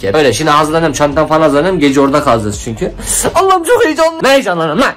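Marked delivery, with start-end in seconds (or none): 3.3-4.9 excited. Real, not acted. Excited about the trip.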